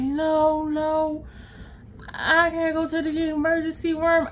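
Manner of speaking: droning, thick-tongued refusal; imitated slurred friend. Friend refusing the emergency room, mimicked impaired speech. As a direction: mimic speech through a swollen tongue, on one note. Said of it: "why are you speaking the way that you're speaking".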